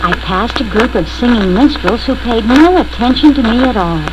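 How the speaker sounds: ordinary delivery, nothing marked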